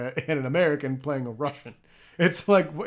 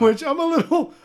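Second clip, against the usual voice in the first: laughing; 10 semitones higher, 5 dB louder than usual. Real, not acted. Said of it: "self-repair, said twice".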